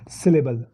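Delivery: सिलेबल said as a model pronunciation, low and falling; pronunciation demo. Shown as a demonstration. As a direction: demonstrate how to pronounce सिलेबल, pitch dropping. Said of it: "इसको pronounce कैसे करते हैं, बोलते हम कैसे हैं". (सिलेबल का उच्चारण करके दिखाते हुए, नीचे गिरते सुर में)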